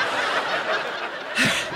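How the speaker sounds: long, weary sigh; heavy sigh at mother; exasperated sigh. Acted. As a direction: let out a long breath of strained patience. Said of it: "Are you still sighing?".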